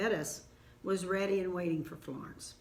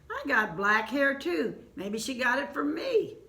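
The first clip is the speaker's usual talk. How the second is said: musing self-credit; 6 semitones higher, 6 dB louder than usual. A narrator's reading. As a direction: lift it up, pleased to claim the credit. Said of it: "He thought, "Huh".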